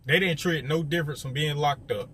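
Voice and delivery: ordinary talk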